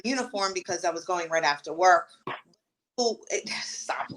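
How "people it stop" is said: sigh, then a whispered stop; whispered aside. Real, not acted. Podcast guest breaks off to hush someone, whispered. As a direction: hush it under your breath.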